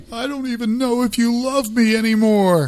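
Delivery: high-pitched mock wail of a wife in tears; hammed-up tearful wife. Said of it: "breaks down in tears".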